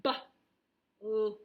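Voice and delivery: B-L-U-E sounded out letter by letter; sounding out. Shown as a demonstration. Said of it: "or it sounds like this".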